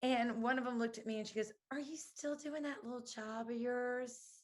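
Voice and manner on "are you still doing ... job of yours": mock-condescending, slow and softer; imitated insult. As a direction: imitate a belittling question, drawn out. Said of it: "meant to insult me".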